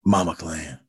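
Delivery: very deep Judge Dredd impression; Karl Urban's Dredd. Co-host showing host his Dredd, very deep voice. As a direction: drop way down, letting the end fade. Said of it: "Oh, I can do this".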